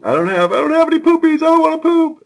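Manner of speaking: put-on fast, high, loud child whine; whiny little boy. Father imitating son refusing to poop, high whine. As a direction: plead like a scared toddler.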